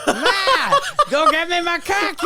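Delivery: very high, loud mock Boston parrot; squawking Boston bird. Mocking a friend's Boston accent, high parrot squawk. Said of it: "doing an impression of a Boston bird".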